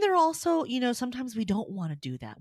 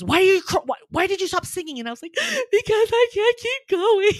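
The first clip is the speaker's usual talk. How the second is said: much higher and louder, with a gasp, replaying a choked-up moment; retold tearful exchange; 12 semitones higher, 10 dB louder than usual. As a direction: act out breaking down mid-song, hammed-up. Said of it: "And I started crying".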